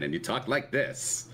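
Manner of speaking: lightly drawled Mid-Atlantic man; Mid-Atlantic accent, man's version. Shown as a demonstration. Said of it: "the Mid-Atlantic accent is something that changes if you're a woman".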